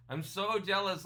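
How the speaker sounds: high, loud and excited; really mean how jealous you are; gushing envy. Real, not acted. Vlogger envious of a friend's vacation photo, excited. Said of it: "I was like, "Dude, I'm so jealous".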